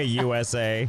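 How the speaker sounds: ordinary talk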